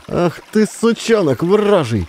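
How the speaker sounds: high, slow furious cursing; enraged outburst. A narrator's reading. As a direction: explode at the boy. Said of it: "взъярилась баба". (разъярённая баба бранит пацана)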